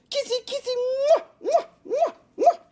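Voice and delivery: very high, squeaky smooching noises; mock kisses. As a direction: smother her in silly kisses. Enchanted Lysander smothers Helena.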